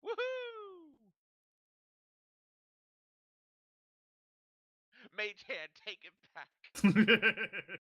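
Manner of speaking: high, loud gleeful cheer; excited whoop. Real, not acted. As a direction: whoop with delight, then laugh. Cheering at the table.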